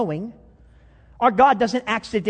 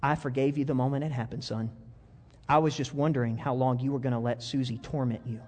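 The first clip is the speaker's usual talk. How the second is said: tender mother; 6 semitones lower, 6 dB quieter than usual. Acted. Mother tenderly tells son.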